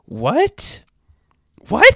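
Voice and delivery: high incredulous what, rising; baffled. Retold or imitated. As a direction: sound totally thrown. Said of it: "and you're like".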